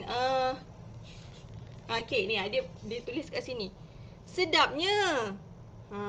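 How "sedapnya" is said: drawn-out, higher "Sedapnya" full of delight; delighted intonation. Shown as a demonstration. Mother reads a child's delight, slow and excited. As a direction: savour "Sedapnya" slowly like a treat. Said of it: "buatlah intonasi seronok kan".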